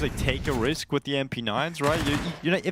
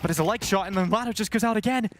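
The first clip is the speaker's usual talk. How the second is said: high, excited play-by-play; esports caster; 6 semitones higher than usual. Acted. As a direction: call the action live, rising. Live play-by-play calling.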